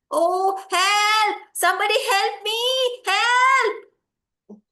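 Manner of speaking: loud, high, drawn-out call; shouting for help. Acted. Lost boy calls out in the forest, loud shout for help. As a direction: shout out as a lost child needing rescue. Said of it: "Basava shouted once again".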